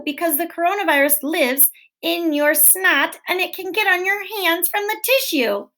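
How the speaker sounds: ordinary delivery, nothing marked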